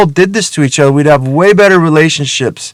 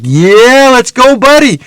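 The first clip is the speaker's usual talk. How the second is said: high, louder gung-ho whoop; rally a buddy with rising hype; excited; 8 semitones higher, 3 dB louder than usual. Retold or imitated. Hustler as the money starts rolling in, excited cheering. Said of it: "I'm excited".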